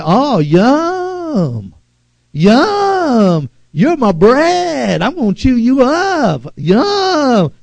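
high, savoring mock yum; relishing taunt. Shown as a demonstration. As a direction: smack your lips at the devil, slowly.

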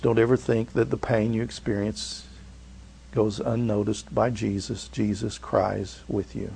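soft, hushed reassurance, slowing down; tender comfort. Real, not acted. Pastor gently assures the hurting congregation, soft and tender.

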